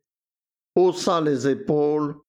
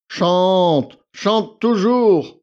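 higher, louder, slow sarcastic drawl; mock her lament scornfully; 5 semitones higher, 6 dB louder, much slower than usual. A narrator's reading. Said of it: "murmura Victor". (Victor raille, lent, plus aigu et plus fort)

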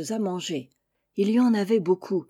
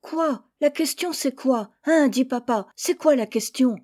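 pestering chicks; 5 semitones higher than usual. Acted. Chicks pester their father with questions, high insistent nagging. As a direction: nag papa again and again. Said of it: "demandaient sans cesse les poussins".

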